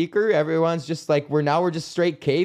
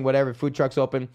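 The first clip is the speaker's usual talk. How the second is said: usual speaking voice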